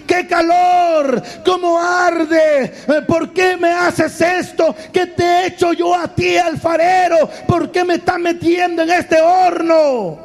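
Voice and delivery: high, drawn-out cries of burning clay; hammed-up pained yelling, high and slow; acted screaming. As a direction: wail in protest at the heat, pitched high. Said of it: "comienza a gritar y dice".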